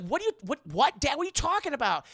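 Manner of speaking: high-pitched, stammering disbelief; bewildered protest. Retold or imitated. Anna hears dad wants a trial, bewildered protest. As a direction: question him in alarmed confusion.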